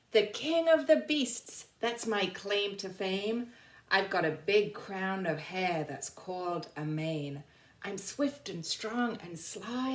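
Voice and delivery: put-on reading poem to children. Teacher reading the lion's poem to the class, grand and lilting. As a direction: give the lion's boast a grand storybook lilt.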